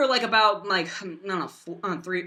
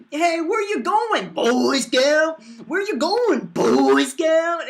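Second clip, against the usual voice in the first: high, drawn-out mocking call; goofy taunt; 7 semitones higher, 5 dB louder, much slower than usual. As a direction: jeer it in a silly swooping voice. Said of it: "what kind of, uh, taunt is that".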